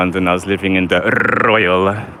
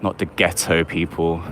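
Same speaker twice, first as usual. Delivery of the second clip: ordinary delivery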